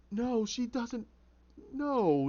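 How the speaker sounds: high-pitched, insistent refusal; distressed denial. Acted. Jervis refusing what the inner voice says, distressed.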